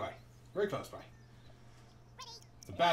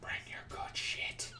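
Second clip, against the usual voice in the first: whisper; 6 dB quieter, slower than usual. Acted. Taunting game enemies.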